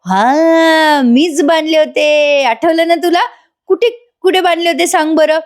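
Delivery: high, drawn-out taunt; hammed-up mocking confession; gloating husband act. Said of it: "Nagraj अक्षरशः हसतोय".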